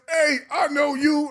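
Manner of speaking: high, drawn-out shout; loud, high-pitched call of recognition; loud greeting. Retold or imitated. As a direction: holler it slowly, imitating the sister. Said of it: "she did not lower her voice at all".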